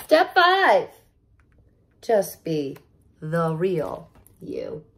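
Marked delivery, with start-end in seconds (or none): 2.0-4.8 soft, slow tender words; gentle reveal. Acted. Reader gently tells kids to be themselves, tender.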